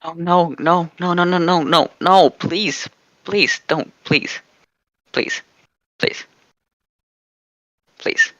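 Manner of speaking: repeated plea losing force; pleading. Pretend. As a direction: beg desperately, then dwindle to weak, spaced-out pleas. Mock pleading against hearing the reading.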